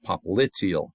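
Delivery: popliteal slowly as pop-LIT-ee-ul; stress on second syllable. Shown as a demonstration. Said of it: "Accent on the second syllable".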